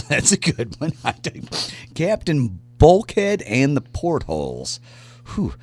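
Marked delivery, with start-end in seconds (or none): 1.9-4.8 radio announcer voice. Acted. Repeats band name, slow announcer read.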